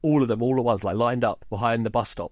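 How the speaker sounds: ordinary delivery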